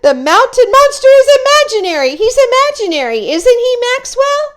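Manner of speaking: terrified scream. Acted. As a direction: shriek it out, begging to be told it isn't real. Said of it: "both screamed".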